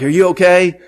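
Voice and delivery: a sudden worried question; alarmed call. Retold or imitated. Asking a cousin just hit by flame, alarmed. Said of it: "I'm like".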